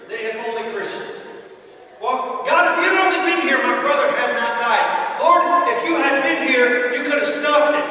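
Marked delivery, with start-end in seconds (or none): 2.0-7.9 loud, high complaint. Acted. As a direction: moan your regrets at God.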